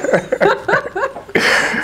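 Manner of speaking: high burst of laughter. Real, not acted. Recalling her mother's approval, amused laughter. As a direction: crack up mid-story.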